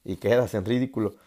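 ordinary talk

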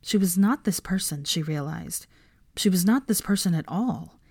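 plain reading voice

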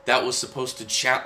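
ordinary talk, nothing marked

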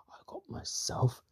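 hushed, breath-only acted whisper; soft voiceless character line. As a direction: whisper it like a secret. Character confiding in put-on whisper.

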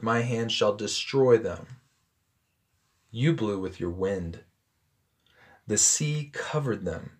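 measured, solemn Bible reading; scripture reading. Acted. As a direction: read the passage to the congregation with weight.

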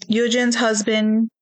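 ordinary talk

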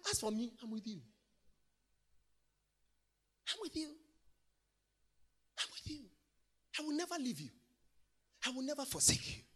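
God softly promising presence; tender reassurance. Acted. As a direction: speak it gently to one listener. God's tender promise, voiced softly.

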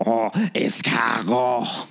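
slow, drawn-out fake French; French chef. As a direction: savour it like a hammy French chef. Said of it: "this episode's accent".

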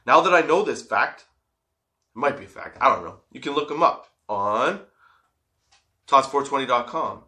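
ordinary delivery

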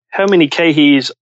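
usual speaking voice

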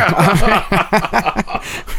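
a burst of genuine laughter; real laugh. Real, not acted. Host cracks up at the barbershop story.

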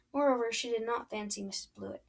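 usual speaking voice

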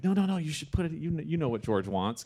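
high, pushy no no no; insistent urging. Retold or imitated. Colleague insisting he submit his own audition, high and pushy. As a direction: press him hard to send it in. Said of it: "And he's like".

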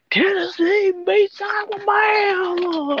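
high, drawn-out mock shout; stretched-out, high-pitched retold announcement; mock shouted headline. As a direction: wail the upset result in a high voice. Acts out hearing upset result.

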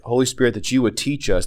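normal talking voice

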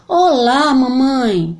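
voz aguda de menino; boy voice. Acted. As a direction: menino cumprimentando a mãe. João. (voz de menino)

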